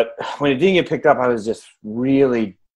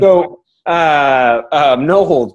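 pushing words out through a burp; 5 semitones higher, 7 dB louder, much slower than usual. Real, not acted. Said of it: "I'm gonna talk through a burp".

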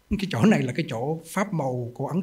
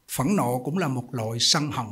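ordinary delivery

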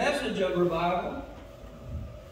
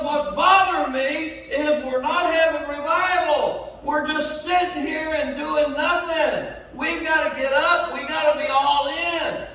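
louder and higher, driving the sermon home; full-force preaching; 5 semitones higher, 4 dB louder than usual. Acted. Pastor rousing his church to revival, preaching at full force. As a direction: preach it hard to the pews.

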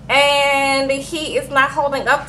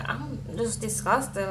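softly disgusted; 3 semitones lower, 12 dB quieter, slower than usual. Retold or imitated. Bride refuses food.

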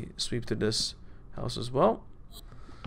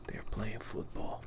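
hushed whisper; 2 semitones lower, 13 dB quieter than usual. Real, not acted. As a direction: whisper it, hiding from them.

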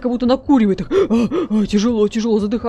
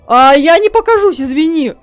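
loud teasing; 4 semitones higher, 6 dB louder than usual. Real, not acted. Teasing a guard while hidden.

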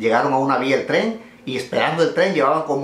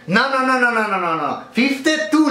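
excited protest; 8 semitones higher than usual. Acted. Cashier jumping in to correct the total, excited. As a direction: jump in fast and high to correct him.

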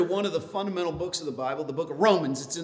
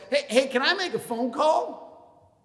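clerk voice; 9 semitones higher than usual. Retold or imitated.

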